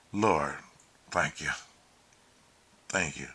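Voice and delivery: low, hushed prayer of thanks; thanking the Lord. Retold or imitated. Driver thanking the Lord on the way to the airport, hushed and low. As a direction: murmur gratitude, softly and low. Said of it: "thanking the Lord".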